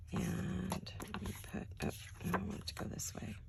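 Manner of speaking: hushed, breathy whisper to herself. Real, not acted. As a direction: whisper so nobody wakes up. So I'm trying to be quiet.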